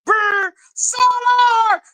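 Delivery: high slow shrieking yell; crazed mom yell. Retold or imitated. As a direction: shriek like a frantic mother. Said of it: "she was at two seconds from doing".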